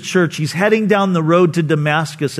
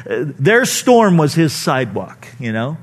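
usual speaking voice

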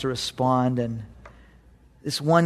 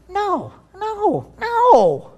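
much higher, rising "no, no, no"; God correcting Elijah; God answering; much slower than usual. Retold or imitated. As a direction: gently overrule a sulking prophet. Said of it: "And God says".

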